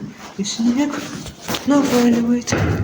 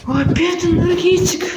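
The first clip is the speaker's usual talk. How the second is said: surprised; 10 semitones higher, 6 dB louder than usual. Real, not acted. Finds yet another energy drink.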